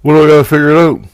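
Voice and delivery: panicked question. Retold or imitated. Panicked soul begging for answers.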